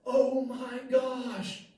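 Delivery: high, drawn-out shocked cry; retold shout. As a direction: cry it out in sudden alarm. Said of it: "Danielle shouts out".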